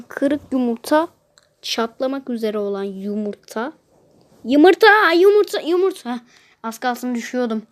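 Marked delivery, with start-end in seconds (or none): 4.4-6.1 higher and louder, chanting the egg word; excited calling out. Real, not acted. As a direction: urge the egg to come, out loud. Oyun sırasında heyecanla sayıklama. (heyecanla yüksek sesle tekrar)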